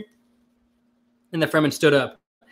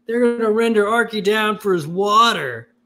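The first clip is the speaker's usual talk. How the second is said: higher, louder mock snarl; a hammed-up angry protest, higher and louder; 8 semitones higher, 4 dB louder than usual. A narrator's reading. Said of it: "the man with the laser gun snarled".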